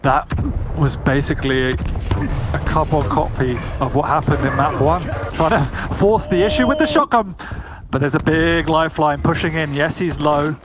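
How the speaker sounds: live play-by-play commentary; esports caster. Real, not acted. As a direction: call the match as it happens. Casters calling the match.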